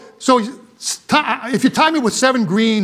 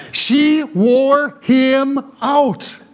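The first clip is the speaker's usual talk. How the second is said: drawn-out emphasis; 3 semitones higher, much slower than usual. Real, not acted. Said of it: "drawn-out emphasis".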